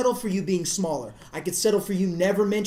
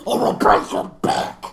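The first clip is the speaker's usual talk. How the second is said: breathy, louder masked threat; Bane impression; 5 dB louder than usual. Fan doing Bane's back-breaking threat. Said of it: "You know how he does that?".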